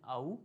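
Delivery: आऊ as one "ow" glide; merged A-U. Shown as a demonstration. Teacher joining A and U, single diphthong. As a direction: slide from open ah into oo on आऊ. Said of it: "दोनों को मिला के बोलते हैं".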